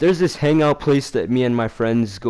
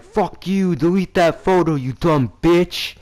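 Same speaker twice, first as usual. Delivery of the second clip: high-pitched acted annoyance, drawn out at the end; mock-angry insult; retold pissed-off outburst; 6 semitones higher than usual. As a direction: re-enact an angry demand. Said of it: "I was getting pissed".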